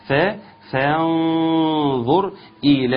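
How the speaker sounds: فا held thin, then فانظر with a heavy ظ; light fa demo. Shown as a demonstration. Teacher modelling فانظر after the rule, slow and deliberate. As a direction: stress the thin فا before the heavy ظ. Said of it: "خلص الفاء المرقق".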